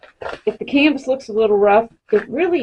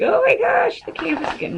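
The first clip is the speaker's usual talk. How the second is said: high mock-panicked viewer, much higher; imitating worried viewer. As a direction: mimic an alarmed viewer, hammed up. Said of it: "You're probably like".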